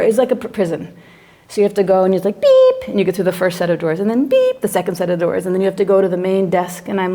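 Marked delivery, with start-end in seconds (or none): 2.4-2.9 high, loud beep; imitated door buzzer. 4.3-4.6 high-pitched beep; imitated door buzzer. Second security door buzzer.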